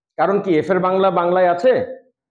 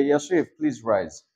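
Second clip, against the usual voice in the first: soft request; 9 semitones lower, 6 dB quieter than usual. Shown as a demonstration. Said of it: "আমি তোমাদের যে কারোর কাছে গিয়ে বলতে পারি যে".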